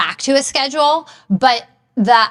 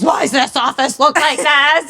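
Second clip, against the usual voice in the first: slightly higher mock-critical quote; playful imitation of a disapproving walk-in; Rick's exasperated question; 4 semitones higher than usual. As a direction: lightly imitate someone appalled by the mess. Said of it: "if Rick were to walk in my office".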